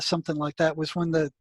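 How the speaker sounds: ordinary talk, nothing marked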